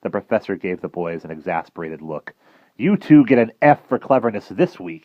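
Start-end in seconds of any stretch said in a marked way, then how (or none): 2.8-5.0 louder gruff scolding; mock growl. Professor scolding the boys, mock growl. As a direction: grumble at the boys in jest. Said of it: "he growled".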